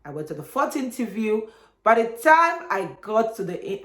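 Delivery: ordinary delivery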